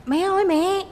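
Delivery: high, mock-urgent call for mom; wife calling her mother-in-law for help, high and urgent. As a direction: cry out for mom like a tattling kid.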